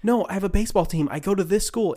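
acted-out hurried explanation; imitating urgent explaining. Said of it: "And he's, like, explaining".

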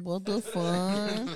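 slow, laughing what the fuck; laughing mock confusion. Foreign listeners baffled by the podcast, slow laughing disbelief. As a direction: drag it out through laughter. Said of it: "they're like".

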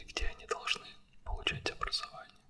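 ordinary delivery

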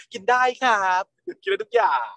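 high, eager-to-please cute reply; acting cute. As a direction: play the adorable good boy. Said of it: "ทำตัวน่ารัก".

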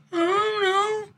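put-on high, crying little-kid voice; whimpering kid. Scared child answers mom, high and on the verge of tears. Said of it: "I'm like".

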